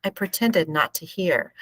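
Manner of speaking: plain reading voice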